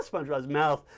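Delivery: ordinary delivery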